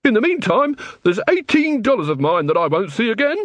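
high, sharp shopkeeper complaint; Mister Flannel sharply pressing his complaint. A narrator's reading. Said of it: "he said sharply".

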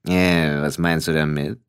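low voice, mildly confused; heavy-voiced figure, puzzled; puzzled Death. A narrator's reading. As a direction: ask in a deep voice, a touch baffled. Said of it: "Dann fragte Tod verwirrt".

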